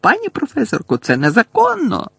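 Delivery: put-on alarmed girl's high protest; crying out. A narrator's reading. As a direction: object urgently, voice jumping up. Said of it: "закричала Герміона".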